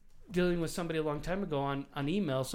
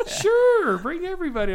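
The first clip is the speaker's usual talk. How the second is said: much higher and louder, a gushing "sure"; eager invitation; 12 semitones higher, 9 dB louder than usual. Retold or imitated. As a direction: burst out with a cheerful, over-eager welcome. Friend's eager reply retold.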